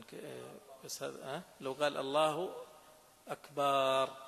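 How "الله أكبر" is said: "أكبر" stretched into "آكبر", loud; drawn-out takbir. Shown as a demonstration. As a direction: loudly lengthen the first vowel of "أكبر". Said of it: "أكبر صار".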